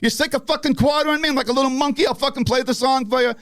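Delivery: high-pitched, acted-out exasperation; indignant rant. Singer grabs the mic after throwing guitar down, indignant. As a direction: rail sarcastically at the crowd.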